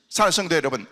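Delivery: a fervent, loud blessing over the congregation; preaching blessing. Acted. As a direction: proclaim it at full force from the pulpit.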